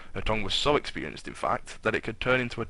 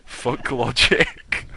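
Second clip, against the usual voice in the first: laughing; 3 semitones higher, 6 dB louder, much slower than usual. Real, not acted. Giggling aside during reading.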